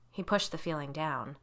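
usual speaking voice

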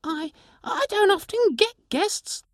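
a lonely sprite stammering; shy pixie. Acted. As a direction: pixie admitting it seldom has guests. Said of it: "the sprite said".

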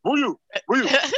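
"Booyah" in a Liberian accent, pitched high and rising. Shown as a demonstration. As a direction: call the name the way his Liberian dad would. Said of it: "in the Liberian accent".

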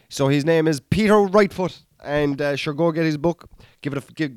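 ordinary talk, nothing marked

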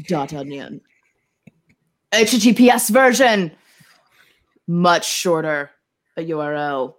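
hammed-up sinister hacker voice; dramatic ransomware reading. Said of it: "That was in the style of the Ziggy ransomware".

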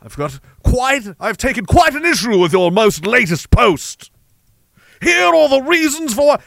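hammed-up posh British internet critic; mock posh accent. Online critic nitpicking a post, hammed-up posh British accent. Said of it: "that's the wrong accent".

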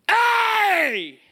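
piercingly high, loud, drawn-out "hey"; all-out sudden holler; loudest prank scream. Retold or imitated. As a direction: scream to win the noise contest. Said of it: "Each time was louder and louder".

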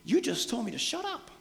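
high, incredulous talk-back; indignant retort. Retold or imitated. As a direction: throw their words back at them. Bartimaeus mocking the crowd.

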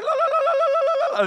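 very high rapid la-la trill; sing-song bubbling noise for blinking dots; imitated typing dots. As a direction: trill a silly high-pitched "la la la". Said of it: "three dots that show up. They're like".

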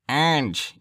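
exaggerated Michigan twang through the nose; nasal Michigan accent. Mimicking how Michigan people say orange, nasal accent. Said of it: "talking through their nose".

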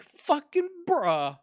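very slow, high, drawn-out bruh; long, high, incredulous groan of a bruh; disbelieving bruh. Retold or imitated. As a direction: stretch it out in stunned disbelief. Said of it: "And I'm like".

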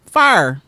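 "fire" as one syllable, like "far"; country pronunciation. Shown as a demonstration. Host shows her Texan slip, "fire" said as "far". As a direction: flatten "fire" into a country "fahr". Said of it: "when I'm just talking".

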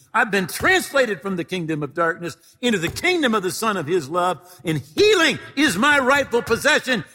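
loud, high commanding rebuke; laying a curse. Shown as a demonstration. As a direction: shout down the sickness with authority.

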